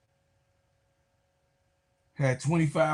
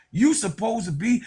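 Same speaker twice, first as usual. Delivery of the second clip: ordinary talk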